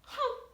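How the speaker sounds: high doubting Caribbean hum; Caribbean parent not buying it, high hum; high skeptical hmm. Retold or imitated. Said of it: "if you ever hear a Caribbean person go".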